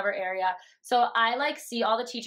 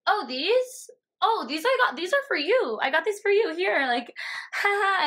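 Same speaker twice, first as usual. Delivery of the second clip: much higher, sugary fake-innocent offering; 8 semitones higher than usual. Caught by the teacher with drinks, much higher fake innocence. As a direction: play dumb, high and sweet, ending in a nervous laugh.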